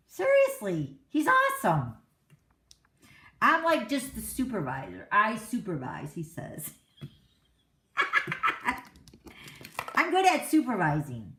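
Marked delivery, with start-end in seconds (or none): 0.1-1.9 high and loud, praising him; much higher, louder happy praise; excited gush. Real, not acted. Gushing about her husband. 7.9-8.8 airy high-pitched laugh; short giggle way up high; high breathy giggle. Real, not acted. Laughs at husband's joke.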